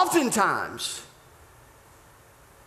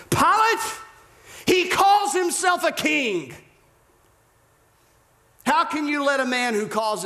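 shouted accusation; 11 semitones higher than usual. Acted. Caiaphas throws his trump card at Pilate, shouting. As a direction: call it out as a damning charge.

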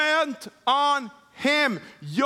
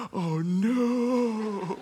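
drawn-out "oh no" of dawning horror; mock dread; 3 semitones lower than usual. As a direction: act out a father's sinking dread, long vowel. Father dreads the dirty hands.

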